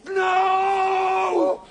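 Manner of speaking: a high, loud, long crying shout; anguished howl in the dark; desperate scream. Acted. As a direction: wail it out in despair. Traveller screams in despair.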